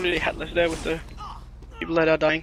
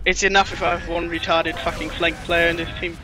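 ordinary talk

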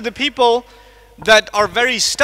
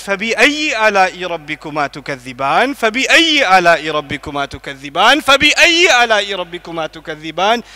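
fast, chanted, slightly lower recitation; repeated recitation; 4 semitones lower, much faster than usual. Acted. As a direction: chant the refrain over and over. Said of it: "So Allah says".

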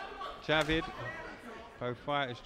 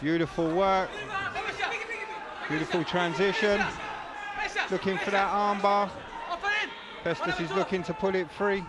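excited commentary; 5 semitones higher than usual. Real, not acted.

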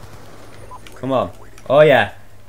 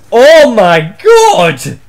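higher, much louder, slow outraged cry; stretched-out loud oh my god; shocked shout; 7 semitones higher, 11 dB louder, much slower than usual. Real, not acted. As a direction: blurt it out in disbelief at the game. Enemy with target finder.

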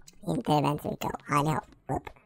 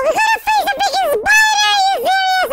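high, loud, fast squeal of dismay; playful high-pitched freak-out; squealing alarm; 5 dB louder than usual. Real, not acted. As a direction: shriek about the spider in a mock panic. Shrieking at the spider.

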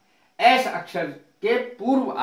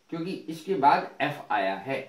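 usual speaking voice

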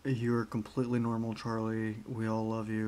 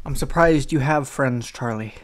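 louder, playful teasing shout of I'm surprised you have friends; loud teasing jab; 10 dB louder than usual. Real, not acted. Teasing Charlie about having friends, a loud jab.

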